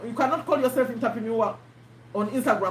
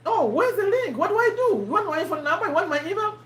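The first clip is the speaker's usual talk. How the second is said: imitating an eager buyer, pitched higher with a laugh; eager prospect; 6 semitones higher than usual. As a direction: play the keen customer asking where the link is. Prospect eagerly asking for link.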